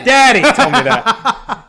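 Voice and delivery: high, loud, drawn-out mimicry of a child; smirking tattletale. Daughter blaming daddy to grandmother, mock tattling. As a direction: imitate a daughter gleefully dropping someone in it. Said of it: "like, a smirk".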